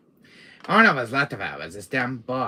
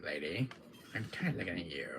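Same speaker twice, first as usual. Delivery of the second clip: normal talking voice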